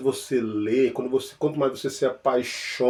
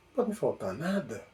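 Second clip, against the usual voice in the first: ordinary talk, nothing marked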